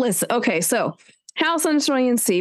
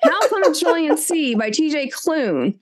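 laughing; 6 semitones higher than usual. Real, not acted. Laughing through list mix-up.

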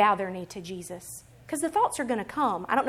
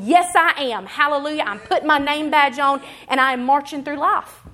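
louder, higher, rallying affirmation; triumphant declaration; 4 semitones higher, 7 dB louder than usual. Acted. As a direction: proclaim it with bold conviction. Congregation proudly answers who they are.

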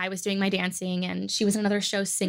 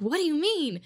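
lightly acted puzzlement, pitched up; puzzled reaction; 5 semitones higher than usual. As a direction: retell a baffled question. Acted retort in audition anecdote.

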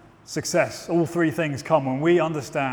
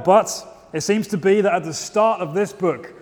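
ordinary talk

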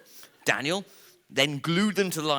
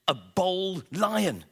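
higher, slow, dismayed cry; shocked exclamation; 8 semitones higher, 4 dB louder, much slower than usual. A narrator's reading. Storyteller revealing Gronk is bald, a slow high shocked exclamation. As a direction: gasp it out as a horrified discovery.

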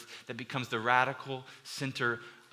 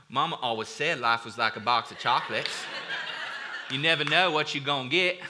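Forrest Gump; 3 dB louder than usual. Acted. Preacher attempts a movie quote for the congregation, Southern accent. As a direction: try a hammy Southern accent impression. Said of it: "gonna try the accent on this one".

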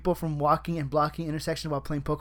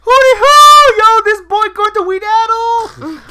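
very high, loud whooping hype call; hyped hoot; 17 dB louder, slower than usual. Pretend. Coworker mocking the Seattle trip, loud high whooping. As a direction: holler it slow like a hype man. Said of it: "maybe I should be like".

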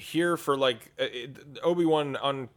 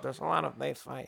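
George Lucas voice; 2 semitones lower, 7 dB quieter than usual. Retold or imitated. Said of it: "just talk about".

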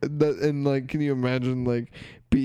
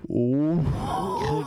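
mock shocked gasp; 7 semitones higher than usual. Acting out the shocked video caption.

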